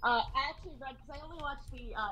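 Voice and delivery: ordinary delivery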